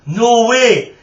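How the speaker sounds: loud, high gasp of disbelief. Acted. George gasping at Kenny's news, loud and high. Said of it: "gasped George".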